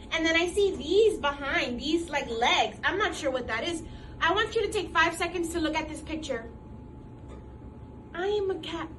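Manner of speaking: ordinary delivery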